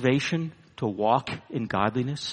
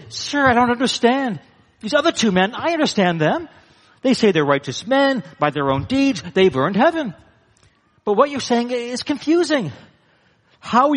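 higher, bewildered asking; puzzled question; 9 semitones higher than usual. Acted. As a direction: sound honestly confused by his answer. Confused questioner asks.

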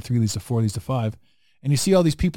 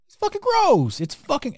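sudden much higher, disgusted outburst; disgusted squeal; 13 semitones higher than usual. Real, not acted. Telling his friend how gross cigarettes are, high disgusted squeal.

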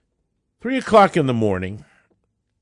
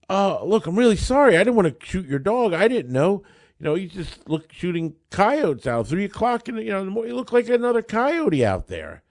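apologetic; 9 semitones higher than usual. Retold or imitated. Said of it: "he's very apologetic".

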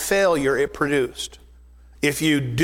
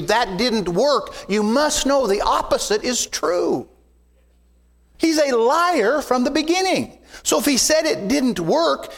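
rising preaching; 6 semitones higher than usual. Acted. Pastor to congregation about the devil, building preaching fervour. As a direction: pitch climbs as the sermon builds.

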